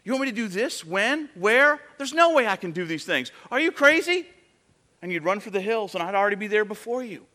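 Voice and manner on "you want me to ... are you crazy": panicked protest. Retold or imitated. Believer panicking at Jesus's plan, voice climbing into a mock shriek. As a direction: build from disbelief into a frantic yelp. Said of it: "And you say".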